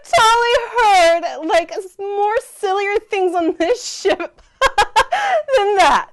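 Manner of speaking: laughing through the words; high giggly reply; giggling. Real, not acted.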